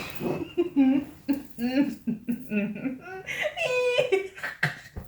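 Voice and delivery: real laugh that cracks up; helpless laugh. Real, not acted. Laughing at her own story.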